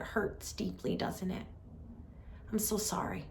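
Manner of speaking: soft, tender words of comfort; compassionate. Acted. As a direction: gently acknowledge someone's pain, softly and sincerely. Voicing a compassionate reply to tears.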